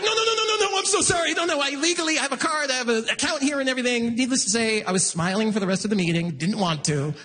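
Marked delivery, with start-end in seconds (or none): 0.0-4.2 high, frantic backpedaling, easing down; panicked apology. Acted. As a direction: babble a high-pitched mock-panicked apology. Panicked apology to bank tellers.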